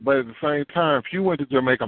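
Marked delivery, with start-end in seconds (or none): none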